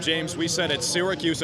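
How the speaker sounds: normal talking voice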